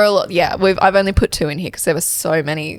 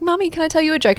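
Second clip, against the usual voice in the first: put-on child voice; 9 semitones higher, much faster than usual. Daughter voiced in story.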